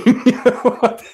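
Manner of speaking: bursting out in high loud laughter; real disbelieving laugh. Real, not acted. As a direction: cackle at the absurd clip. Reacting to Catwoman suit clip.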